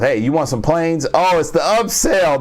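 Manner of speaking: lightly voiced pushy salesman, sing-song lift; mock sales pitch to another country; salesman Trump pitch. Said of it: "He does deal with".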